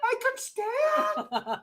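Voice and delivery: very high, drawn-out squeal; hammed-up amazed discovery, stretched out slowly; mock astonishment. Acted drowner discovers shallow water.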